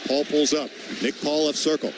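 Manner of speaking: ordinary talk, nothing marked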